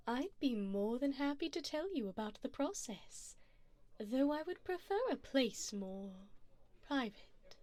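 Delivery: usual speaking voice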